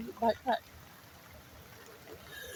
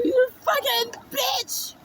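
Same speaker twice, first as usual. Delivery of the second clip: high, loud, drawn-out yell; furious insult; 14 semitones higher, 5 dB louder, slower than usual. Retold or imitated. Retelling the insult hurled in the fight, yelled furiously. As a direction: stretch each word into a high-pitched curse.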